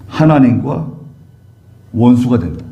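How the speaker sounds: ordinary talk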